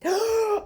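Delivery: sharp high gasp; shocked gasp. Real, not acted. Vlogger gasping at the boyfriend's words. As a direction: gasp in outrage.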